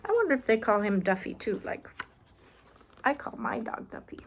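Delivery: ordinary delivery, nothing marked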